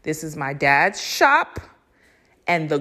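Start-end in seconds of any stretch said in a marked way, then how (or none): none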